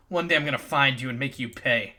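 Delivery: usual speaking voice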